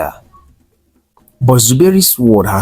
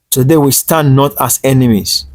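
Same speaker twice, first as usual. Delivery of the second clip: higher, louder and ringing; strong and clear; 5 semitones higher, 3 dB louder than usual. A narrator's reading. Elder opening the union blessing, strong and clear. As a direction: announce it to the whole gathering. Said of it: "her voice strong and clear".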